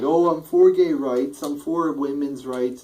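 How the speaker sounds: high, slow, sugary mock politician voice; high-pitched sarcastic impression of a pandering candidate; mocking Hillary. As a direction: parody her fake concern, drawn out and loud. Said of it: "Yet she's like".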